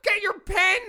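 high hammed-up yell; mock rage. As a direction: rant at the letter writer.

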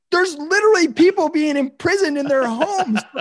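high, laughing outraged rant; mock fury with laughter breaking through; venting at the think tank, high and laughing; exasperated rant. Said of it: "You're like".

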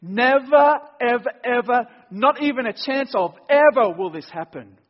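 "Never" shouted high and loud, "ever, ever" hammered after it; emphatic never. Shown as a demonstration. Preacher driving home the Greek negative, shouting never ever ever. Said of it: "This is like saying".

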